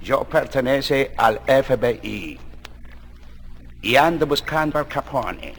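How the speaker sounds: put-on low, slow American-accented Spanish; quiet, deep gringo agent voice; gringo lawman. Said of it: "un gringo introspectuosamente abre la puerta".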